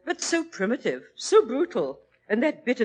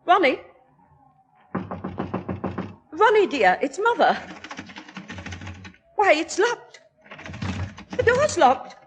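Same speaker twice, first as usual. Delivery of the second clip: frightened calling; 6 semitones higher than usual. Acted. Mother calling her son through a locked door, rising panic. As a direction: call through the locked door in growing alarm.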